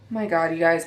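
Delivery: normal talking voice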